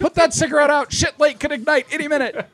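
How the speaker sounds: high, loud warning yell; high alarmed shout. Pretend. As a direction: warn everyone in a panic. Mock alarm.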